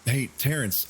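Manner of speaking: calling out, pitched high; a sudden alarmed call; retold yell. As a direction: shout his name across the room. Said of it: "I yelled".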